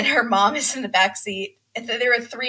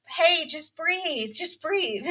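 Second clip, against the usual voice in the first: soft, higher, drawn-out coaxing; mock soothing; 6 semitones higher, 6 dB quieter, much slower than usual. Family calms the crying bride, mock soothing. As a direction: hush someone gently, imitating a calming relative.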